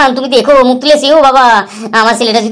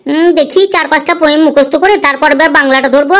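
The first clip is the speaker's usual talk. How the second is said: normal talking voice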